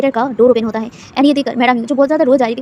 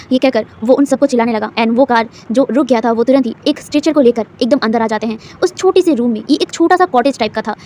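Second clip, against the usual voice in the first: ordinary delivery, nothing marked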